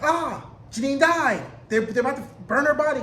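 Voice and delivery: hammed-up high, alarmed cry; playful panic, pitched high; mock panic. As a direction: cry out in pretend horror. Acts own alarm at empty bed.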